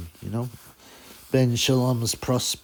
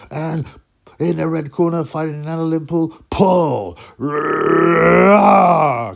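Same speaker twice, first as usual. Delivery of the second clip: mock announcer, louder and higher; ring announcer; 5 semitones higher, 8 dB louder, slower than usual. Mocking an announcer who forgot a boxer's name, booming. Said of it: "called him".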